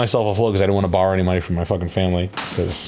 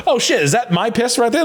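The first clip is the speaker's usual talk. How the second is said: high-pitched mock surprise; retold surprise. Donor spotting his sample at the drug test, high retold surprise. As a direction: retell it in a raised, high voice.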